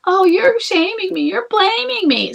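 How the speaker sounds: high, loud whiny complaint with slow, dragged words; mock the husband as a whining baby. Mocking the complaining husband's whine, high and whiny. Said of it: "So he's being a whiny baby".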